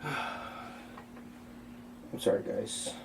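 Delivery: breathy sigh then hushed sorry; deflated, barely voiced apology; sighing whispered apology. Real, not acted. Sighing softly sorry to viewers after weak views.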